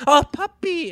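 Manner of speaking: suddenly high, cooing voice; imitated gushing over a puppy; mock puppy adoration. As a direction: melt into a high-pitched coo. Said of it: "they see a dog and they're like".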